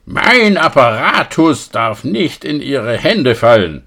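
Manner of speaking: high, loud and slow, half-mad shout; crazed distorted scream. Acted. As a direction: scream it like a man losing his mind. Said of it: "hörte ich seine verrückt verzerrte Stimme schreien". (verrückt verzerrt geschrien)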